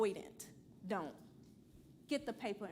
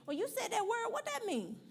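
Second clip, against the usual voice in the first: mock student; 11 semitones higher than usual. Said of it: "and then nobody say".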